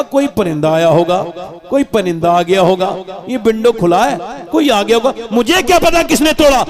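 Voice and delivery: excuses climbing to a high-pitched protest; child's mounting denial. Acted. Cornered child lying to parents about breakage, protesting. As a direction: protest innocence, pitch rising as you go. Child denying blame, acted.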